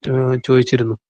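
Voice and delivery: ordinary talk, nothing marked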